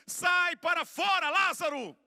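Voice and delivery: high, loud cry with drawn-out final words; commanding shout. Retold or imitated. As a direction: call the dead man out at full voice. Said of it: "ele dá um grito".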